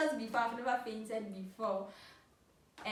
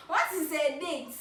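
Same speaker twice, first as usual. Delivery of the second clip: "What is a date?" slowed down, with "What" stressed higher and louder; puzzled emphasis; 4 semitones higher, 4 dB louder than usual. Shown as a demonstration. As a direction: lean hard on "What" in disbelief.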